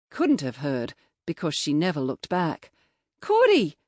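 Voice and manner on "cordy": loud high yell. A narrator's reading. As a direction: yell her name. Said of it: "I yelled again".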